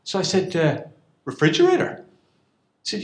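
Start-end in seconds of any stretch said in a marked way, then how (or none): 1.3-2.0 much higher, puzzled question; playing dumb to the host, mock baffled; mock bafflement. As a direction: feign never hearing the word. Feigning ignorance.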